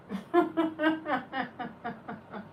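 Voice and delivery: long rattling run of laughter; real laughter. Real, not acted. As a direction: dissolve into a laughing fit. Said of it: "Yeah, I'm laughing".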